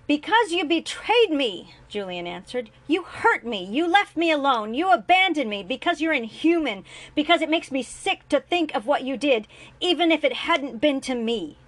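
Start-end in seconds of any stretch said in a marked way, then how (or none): 0.1-1.7 high, loud, slow furious cry. A narrator's reading. Julian to his father, furious and loud. 2.9-11.6 high, loud, hurt rage; raging accusation. A narrator's reading. Julian to his father, raging and loud.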